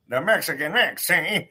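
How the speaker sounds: old-timey announcer, deliberately put on; 1940s announcer voice. Plugging his mama's book again, in an old-timey forties voice. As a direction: say it like a forties radio ad. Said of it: "Why do I keep doing that voice?".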